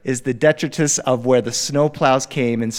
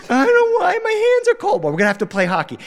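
youngest cousin voice; faster than usual. Retold or imitated. Youngest kid voiced, gloveless.

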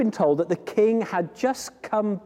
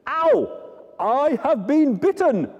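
mock pained king; 13 semitones higher than usual. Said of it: "the king will say".

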